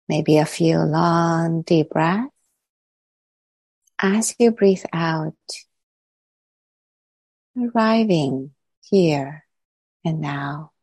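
calm, unhurried meditation voice with long pauses; guided meditation. Real, not acted. Teacher settling the group into body and breath, calm guided meditation. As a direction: guide them gently inward, leaving long silences between phrases.

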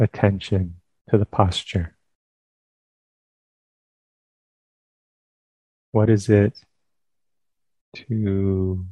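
calm, unhurried phrases with long pauses; guided meditation. Real, not acted. Teacher leading listeners into sitting meditation, calm guided meditation. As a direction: guide them gently into stillness, leaving space between phrases.

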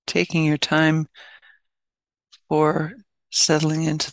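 unhurried, spacious delivery, phrase by phrase; guided meditation. Real, not acted. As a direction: ease listeners into stillness, leaving space between phrases.

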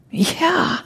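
a squealing yeah while the bus careens; squealed yeah. Acted. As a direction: squeak it out in alarm. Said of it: "I squealed".

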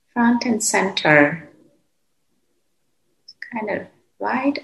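calm, unhurried meditation voice with long pauses; guided meditation. Real, not acted. Teacher guiding meditators to settle into the body, calm and unhurried. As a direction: guide them gently, phrase by phrase, leaving long silences.

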